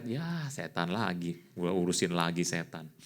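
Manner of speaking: put-upon staffer's mock complaint; imitated secretary. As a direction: lightly play a secretary sighing at yet another case. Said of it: "ya orang yang syariat".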